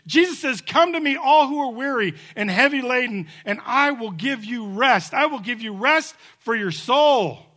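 high, loud, impassioned preacher climax; altar-call preaching at its peak; full-force preaching. Acted. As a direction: plead with the whole room.

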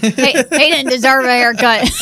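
laughing through the words; a real laugh breaking up the line. Real, not acted. Laughing at the dealer joke.

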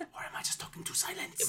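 hushed movie-trailer whisper; imitated trailer whisper. Podcaster mimicking an actor's trailer line, in a whisper. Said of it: "the way he says silence at the end of the trailer".

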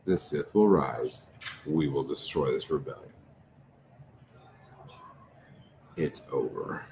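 low, soft, menacing declaration; cold Sith threat. Acted. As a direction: speak it quietly, certain of victory. GM voices Sith villain's threat.

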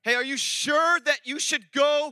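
measured, doubting challenge; pointed wifely questioning. Retold or imitated. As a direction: question him deliberately, spelling it out. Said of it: "when she says to me".

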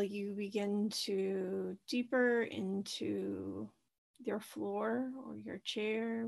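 soft, unhurried meditation voice with long pauses; guided meditation. Acted. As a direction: gently guide listeners into their breath, leaving space between phrases. Leads a guided breathing meditation.

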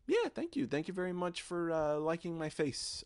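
flattered high voice. Acted. Mock-flattered thanks to drag host.

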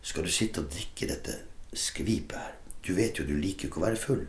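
the craving voice murmuring in his head; inner voice whispering. Retold or imitated. His addiction voice tempting him at dinner, whispered. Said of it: "så sa stemmen min".

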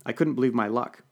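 plain reading voice

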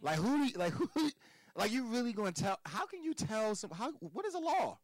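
mock the very idea in disbelief; scoffing at laws with a laugh in the voice; incredulous laughing disbelief. Real, not acted. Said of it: "I really actually laugh at it".

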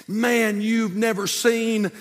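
high, loud, slow preacher build-up; preaching at full force. Acted. Preacher builds the second fight.

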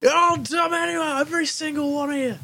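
put-on high, loud, slurred drunk rant; drunk animal yelling. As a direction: yell it out like a tossed-out drunk. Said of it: "as he states".

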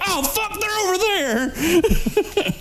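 a shot decoy squealing in a cartoonish panic; mock panic.

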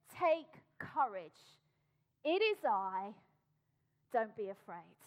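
slow, steady, low comfort; Jesus calming frightened disciples, slow and reassuring; calm reassurance. Acted. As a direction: speak it slowly to calm them. Jesus' words spoken slowly.

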